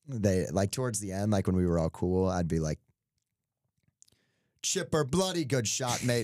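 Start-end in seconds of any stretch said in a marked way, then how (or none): 4.6-6.3 high, slow mock British cheer; hammed-up fake British caddie banter; bad British-Australian accent. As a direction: put on a drawn-out British accent, slipping Australian. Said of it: "That was kind of Australian too".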